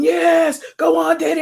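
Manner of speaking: high, eager cheer from the sidelines; mock cheering mom. Mom cheering from the sidelines, high and excited. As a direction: cheer him on like a hyped-up sports mom. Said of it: "my mom on the side going".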